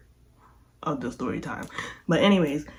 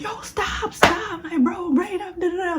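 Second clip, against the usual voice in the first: very high shouted stop; shrill high yell to stop a fight; 12 semitones higher than usual. Retold or imitated. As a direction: cry out to break up the fight. Yelling to break up fight.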